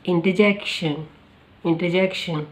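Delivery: इंटरजेक्शन with "er" turned into "a": "inta-jekshun"; इंटरजेक्शन as "in-ta-JEK-shun"; pronunciation demo. Shown as a demonstration. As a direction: say इंटरजेक्शन without the r sound. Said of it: "ई आर again आ में आएगा change".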